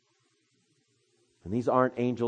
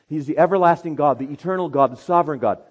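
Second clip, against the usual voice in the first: fast, loud, higher proclamation; fast preach; 7 semitones higher, 13 dB louder, faster than usual. Acted. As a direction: drive it home to the congregation. Preaching at full force.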